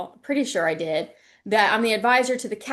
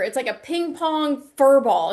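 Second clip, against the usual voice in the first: ordinary talk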